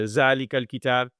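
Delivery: put-on fast Pakistani-accented ذَلِكَ الْكِتَابِ. Teacher imitating a student's Pakistani recitation. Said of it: "Pakistani accent".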